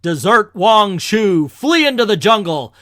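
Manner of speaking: loud, high proclamation; loudspeaker command. Acted. Iron Man takes over the loudspeaker, commanding loudly. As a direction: order the whole camp to run. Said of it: "switch my own voice into the loudspeaker".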